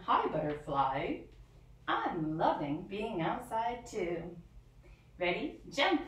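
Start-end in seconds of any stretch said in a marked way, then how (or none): none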